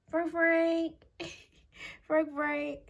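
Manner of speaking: high cooing call, held soft; doting grandma coax. Real, not acted. Grandma coaxing her pet Frank to come, high and cooing. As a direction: sweet-talk him over in a high voice.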